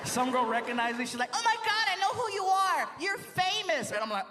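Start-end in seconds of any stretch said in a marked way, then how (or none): 1.3-3.9 high-pitched mock fangirl squeal; excited fan. Club girl recognizes the comedian, acted high excitement. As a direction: gush it in a girl's high voice.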